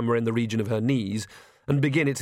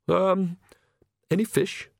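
put-on timid, apologetic young priest, stumbling over words; fretful clergyman who can't get a sentence out; nervous stammering priest; 8 semitones higher, slower than usual. Priest Oates speaks.

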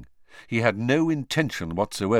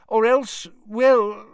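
higher, halting, flustered; embarrassed; 13 semitones higher, 7 dB louder, slower than usual. A narrator's reading. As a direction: trail off awkwardly. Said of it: "Fudge sounded embarrassed".

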